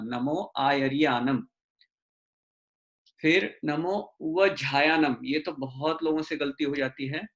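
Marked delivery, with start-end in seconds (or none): 0.0-1.5 नमो आयरियानम slowly with every syllable clear; slow model pronunciation. Shown as a demonstration. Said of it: "आयरियानम ही ध्यान रखना". (धीरे से सही उच्चारण नमो आयरियानम)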